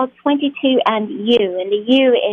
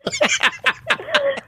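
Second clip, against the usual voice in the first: high-pitched giggle after a failed scream; squealing laugh; embarrassed high laugh; 4 dB quieter than usual. Real, not acted. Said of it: "that was horrible".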